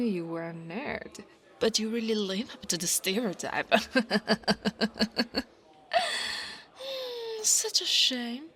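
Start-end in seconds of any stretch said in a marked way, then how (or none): 3.8-5.4 long staccato chuckle; mocking laugh. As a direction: laugh at them in quick bursts. Mocking the nerd stereotype.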